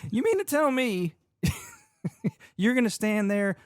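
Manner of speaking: high-pitched, incredulous customer bit; mock indignation. Acted indignant fireworks customer bit.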